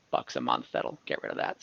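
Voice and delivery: ordinary talk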